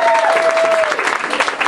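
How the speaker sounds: high, loud cheer; real cheer. Real, not acted. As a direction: celebrate the finish. End of first movie.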